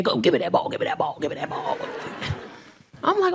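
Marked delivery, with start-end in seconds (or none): 0.0-1.8 low, fading into a breathy chant; grandbaby demanding. Retold or imitated. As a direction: mimic a toddler demanding the ball. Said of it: "They be in there".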